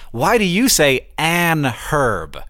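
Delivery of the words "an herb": drawn-out "an herb" with the H pronounced; H sounded after "an". Shown as a demonstration. American challenges Briton's usage, "an herb" slowly with sounded H. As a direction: stretch "an", then sound the H in "herb". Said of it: "reason that the N exists".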